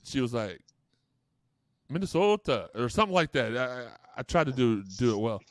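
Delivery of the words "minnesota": high, loud mock Minnesota accent; clumsy, exaggerated Minnesota accent; attempted Minnesota accent. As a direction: overdo a bad Minnesota accent. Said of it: "That was supposed to be my Minnesota accent".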